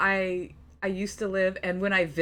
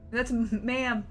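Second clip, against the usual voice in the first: ordinary talk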